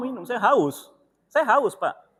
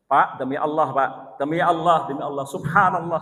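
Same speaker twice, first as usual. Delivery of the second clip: earnest exclamation; 8 dB louder than usual. Retold or imitated. Retelling his earnest exclamation.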